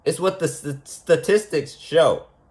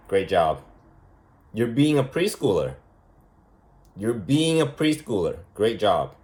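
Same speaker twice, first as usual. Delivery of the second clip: low, deadpan mock praise; sarcastic teacher praise; 6 semitones lower, 4 dB quieter than usual. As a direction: praise the kid with dry sarcasm. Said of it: "As a teacher, I'm like".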